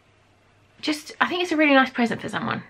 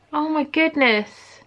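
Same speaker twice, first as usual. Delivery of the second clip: cooing; 6 semitones higher, much slower than usual. Real, not acted. Cooing over tiny earrings.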